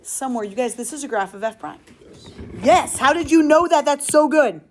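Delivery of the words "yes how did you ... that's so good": louder, higher burst of approval; loud, thrilled teacher reaction; excited praise. Real, not acted. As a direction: praise a student with real excitement. Praising a student's correct answer.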